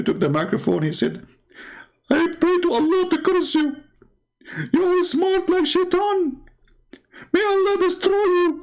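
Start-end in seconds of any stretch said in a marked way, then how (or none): 2.1-8.6 very high-pitched imitation of an outraged man; hammed-up cursing, voice pushed way up; mock furious curse. Retold opponent cursing him.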